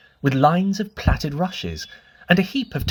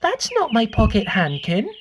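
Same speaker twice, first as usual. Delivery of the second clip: a hint of a curious little girl, higher; lightly voiced little girl; 7 semitones higher than usual. A narrator's reading. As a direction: ask like a small girl, wide-eyed. Lucy voiced in story.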